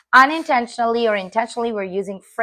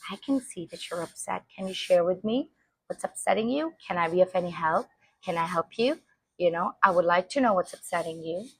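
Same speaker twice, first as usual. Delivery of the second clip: softer, soothing parent voice; gentle validating parent; 5 dB quieter than usual. Shown as a demonstration. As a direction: comfort the upset child gently. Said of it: "validate their emotions by saying".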